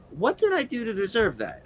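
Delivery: hurt and baffled thought; puzzled inner voice. Retold or imitated. Driver wondering why he got the bird, lightly puzzled. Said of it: "I'm thinking to myself".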